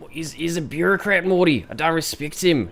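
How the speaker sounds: high, mocking Australian accent as Rick; bad Australian Rick impression. Streamer mimics Rick in a bad Australian accent. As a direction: sneer like a cartoon Aussie scientist. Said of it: "I butcher your beautiful, beautiful accent".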